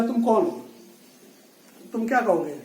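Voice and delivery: ordinary delivery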